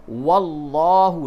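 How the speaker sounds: واللہ with a full, mouth-filling heavy lam. Shown as a demonstration. Teacher showing the heavy lam in واللہ, slow and full. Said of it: "لام کو پُر کر کے پڑھیں گے".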